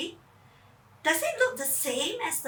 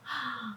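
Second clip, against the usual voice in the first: quiet startled gasp; sharp gasp; 12 dB quieter than usual. Acted. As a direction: gasp in fright. Fright at air show.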